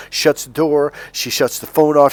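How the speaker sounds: ordinary delivery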